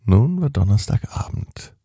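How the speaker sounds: plain reading voice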